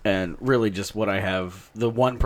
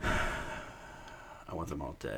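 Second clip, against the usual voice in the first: quiet deadpan threat; 6 semitones lower, 11 dB quieter, much slower than usual. Acted. Fan mocks Kickstarter nerds.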